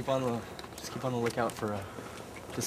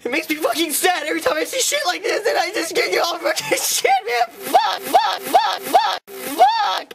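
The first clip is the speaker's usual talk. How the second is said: hammed-up wailing rant, much higher and louder; fake-sob yelling about nature; over-the-top mock despair, shouting; mock outrage; 9 dB louder, slower than usual. Mock outrage over cut trees.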